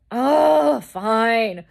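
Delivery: mock exasperated partner relenting; exasperated giving in. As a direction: groan it out loudly, then give in. Retells partner grudgingly giving in.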